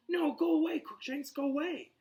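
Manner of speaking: high, urgent shooing; frantic shooing. Acted. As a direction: shoo the cat away frantically. Hermione shooing the cat.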